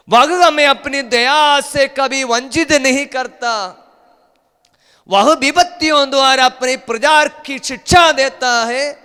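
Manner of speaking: loud, high-pitched preaching cry; full-force preaching. Acted. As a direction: preach it out high and forceful, drawing words long. (ज़ोरदार, ऊँचे सुर में उपदेश)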